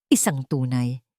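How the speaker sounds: usual speaking voice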